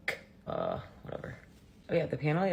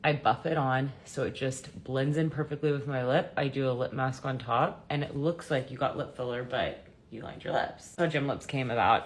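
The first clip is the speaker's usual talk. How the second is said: normal talking voice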